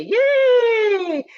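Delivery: high, bright little cheer for Space Day; a sudden burst of delight; excited cheer. Real, not acted. Teacher cheering for Space Day with the class, excited.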